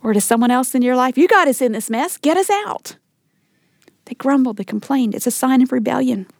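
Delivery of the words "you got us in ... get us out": grumbling demand. Acted. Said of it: "You ever find yourself saying that to your husband?".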